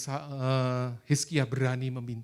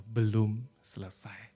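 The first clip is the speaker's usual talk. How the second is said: God's calm answer; 4 semitones lower, slower than usual. Retold or imitated. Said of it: "Tuhan berkata".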